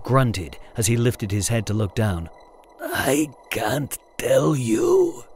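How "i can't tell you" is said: slow, breathy whispered hiss. A narrator's reading. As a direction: hiss it through the pain. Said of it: "he hissed".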